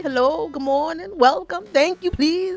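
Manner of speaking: high, drawn-out courtesy act; polite public voice. Acted. Showing how one acts in public, overly polite greeting. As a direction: put on exaggerated public politeness. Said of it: "you polite".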